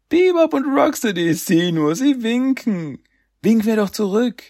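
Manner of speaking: very high, gleeful calling out; excited. Acted. Turtle spots the villains waving from below, high and gleeful. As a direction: squeal it out as a delighted discovery.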